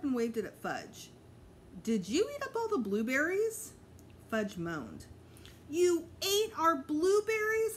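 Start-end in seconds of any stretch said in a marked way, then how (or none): 1.8-3.7 accusing mom. A narrator's reading. Mom confronts Fudge over berries. 5.7-7.8 high, loud, slow shocked question; outraged disbelief. A narrator's reading. Peter finding out Fudge ate their berries, high and outraged.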